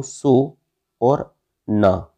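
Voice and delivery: "सो" and "ना" pulled apart, drawn out slowly; split word. Shown as a demonstration. Teacher splitting the word सोना for students, slow split delivery. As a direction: break सो from ना with long gaps. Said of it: "इसको मैं ऐसा तोड़ूँ".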